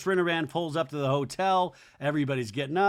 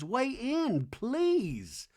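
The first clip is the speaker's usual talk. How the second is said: mock JT plea; 8 semitones higher, much slower than usual. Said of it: "J T looks at Venita and goes".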